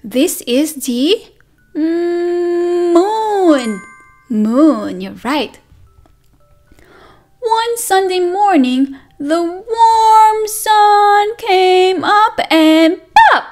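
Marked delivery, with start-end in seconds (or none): none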